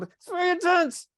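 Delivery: very high, drawn-out mock panic; hammed-up freak-out at the top of the voice; alarmed overreaction. Shown as a demonstration. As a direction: squeal it slowly in exaggerated alarm.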